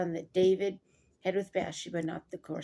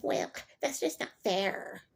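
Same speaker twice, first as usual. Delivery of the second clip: put-on sassy kid. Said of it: "than if they're sassy".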